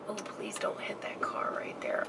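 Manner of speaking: anxious real whisper. Real, not acted. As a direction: breathe it out, afraid of a crash. Nervous while parking.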